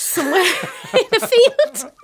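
breaking into a laugh mid-sentence. Real, not acted. Joking about alternative life.

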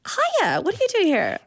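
surprised greeting. Retold or imitated. Coach greeting her at pool.

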